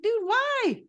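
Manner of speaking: high, shocked disbelief at a friend; a jump up in pitch, like "wait, why?"; baffled outburst. Retold or imitated. Podcast host retelling a character's choice, high baffled outburst. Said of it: "I was like".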